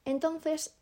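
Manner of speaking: ordinary talk, nothing marked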